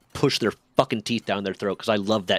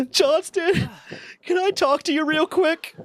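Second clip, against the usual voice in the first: very high, tearful imitation; mock weeping; slower than usual. Weeping sergeant asks airman for a word, mock tearful. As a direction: squeak it out as if crying, hammed-up. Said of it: "fucking weeping".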